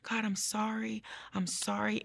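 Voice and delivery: soft, high retold plea; small, subdued voice imitating remorse; apologizing. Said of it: "I kept apologizing".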